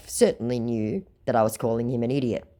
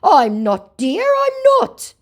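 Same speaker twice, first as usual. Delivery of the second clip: high, loud, pleading mock denial; mock scammer insisting; 10 dB louder, slower than usual.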